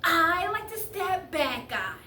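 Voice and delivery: drawn-out kid brother impression; seven-year-old brother. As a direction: declare it proudly like a small child. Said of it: "as he likes to put it".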